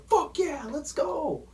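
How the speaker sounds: high, pumped-up imitation of a player celebrating; hyped celebration. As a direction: pump yourself up after a big play. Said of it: "You're gonna be like".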